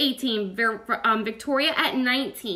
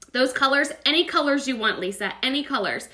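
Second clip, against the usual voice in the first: ordinary talk, nothing marked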